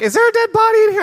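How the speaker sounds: high, loud squeal of mock alarm; squeaky-high, loud kid question. Voicing alarmed kids at church.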